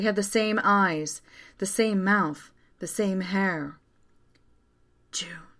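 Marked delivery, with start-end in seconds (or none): 5.1-5.5 hushed, much softer name; whispered. A narrator's reading. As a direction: whisper the name under your breath. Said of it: "I whispered".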